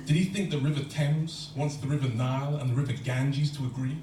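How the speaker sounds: normal talking voice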